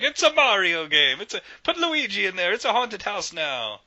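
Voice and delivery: a jolly Italian boss announcing changes; Italian Miyamoto. Retold or imitated. To his staff, high Italian-accented mock decree. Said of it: "why he sounds Italian in my head".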